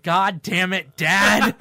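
loud fed-up groan at dad; exasperated son. Retold or imitated. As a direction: yell it at your dad, exasperated. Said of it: "He's like".

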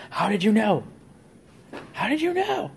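high, rising mock shout of a father; demanding father. Father shaking his son for answers, high imitated demand. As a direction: press him harder, climbing up high.

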